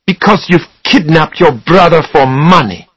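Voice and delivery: high, forceful accusation; shouting. A narrator's reading. Susanna accusing Charlie of kidnapping, shouting. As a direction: hurl the accusation at him. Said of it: "she shouted".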